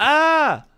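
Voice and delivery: sharp high startled cry; startled yelp. Real, not acted. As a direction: jump at a sudden noise. Startled by sudden sound.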